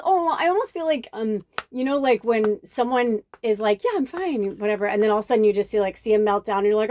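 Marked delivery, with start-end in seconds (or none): 3.8-4.6 bright, high fake-cheerful fine; a hint of forced cheer; mock cheerful "fine". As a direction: brush it off in a high chirpy voice. Mimicking someone hiding distress.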